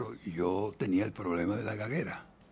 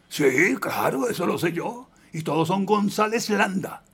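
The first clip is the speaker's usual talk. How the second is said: imitating his father, higher and louder; insistent father; 8 semitones higher, 5 dB louder than usual. Father to son about the missing surname, insistent. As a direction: lean on each point, slow at first. Father questioning the stage name.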